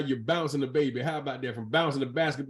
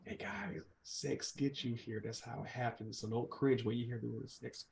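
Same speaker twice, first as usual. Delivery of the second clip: hushed aside; 12 dB quieter than usual. Real, not acted. Aside to viewers.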